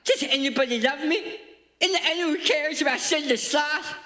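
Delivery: high, whiny sloth voice, sorry for himself; Sid the Sloth. Retold or imitated. Comedian doing the cartoon sloth for the crowd, high and whiny. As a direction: whine it like the lonely cartoon sloth. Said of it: "my Sid the Sloth impression".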